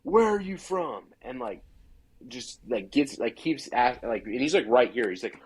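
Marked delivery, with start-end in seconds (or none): 0.0-1.0 higher, angry yelling in his face; a territorial hunter yelling at an intruder; retold screaming. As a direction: tell him to get out, yelling. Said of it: "just starts screaming in my face".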